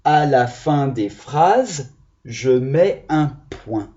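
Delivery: slow, careful reading of the right sentence; slow model reading. Shown as a demonstration. Teacher reads the correct sentence to the class, slow and careful. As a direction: read it out slowly so the children follow.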